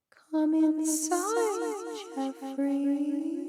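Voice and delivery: high, very slow, otherworldly lure; eerie high whispering-spirit voice; ghostly beckoning. A narrator's reading. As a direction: draw him into the house with a haunting call. Mysterious figure beckons him in.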